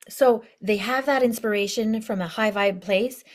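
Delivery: ordinary delivery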